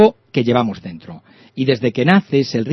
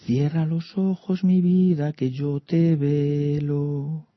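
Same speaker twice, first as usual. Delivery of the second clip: soft lullaby; 6 dB quieter, much slower than usual. Acted. Quoted lullaby line.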